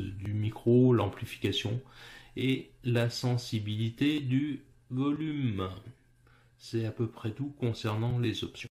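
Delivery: ordinary talk, nothing marked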